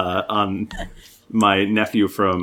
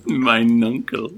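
mock old English; 2 semitones higher, slower than usual. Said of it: "A little Game of Thrones".

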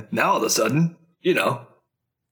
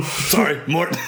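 ordinary talk

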